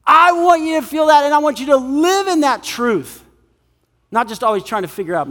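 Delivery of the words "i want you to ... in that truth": high, emphatic proclamation; raised, impassioned declaration; voice of God. Acted. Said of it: "And God says".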